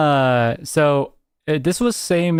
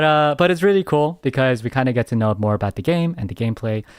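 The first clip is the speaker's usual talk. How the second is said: ordinary talk, nothing marked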